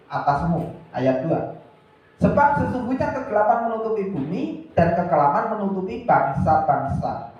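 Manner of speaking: loud, ringing Bible reading; scripture reading. Acted. Preacher reading Isaiah aloud, loud and declaiming. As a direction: proclaim the verse to the hall.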